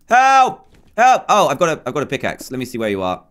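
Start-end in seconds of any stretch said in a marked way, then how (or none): 0.1-1.2 high, loud playful help call; mock cry for help. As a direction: shout help like a stranded player. Calling for help in game.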